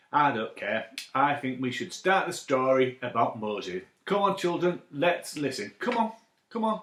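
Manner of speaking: ordinary delivery, nothing marked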